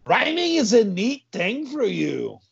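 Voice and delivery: admiring dopey sidekick; Tough Carlos. Acted. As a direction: stretch it out in a high put-on voice. Tough Carlos comments, acted.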